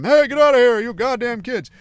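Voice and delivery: angry farmer shouting across the field; yelling at kids. Retold or imitated. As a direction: shoo the kids away with a shout. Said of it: "I'll yell at 'em".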